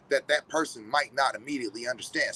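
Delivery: usual speaking voice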